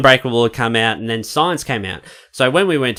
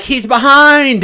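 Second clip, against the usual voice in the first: high, loud, drawn-out cry; mock alarmed shout; 13 semitones higher, 6 dB louder, much slower than usual. Retelling kids at a birthday party, mock alarmed shout. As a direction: call it out high, stretching the words.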